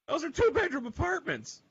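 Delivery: usual speaking voice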